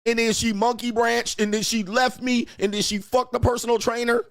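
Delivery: mocking whiny man. Podcaster mocks men listing what their women did, high whine. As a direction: whine it like a self-pitying guy.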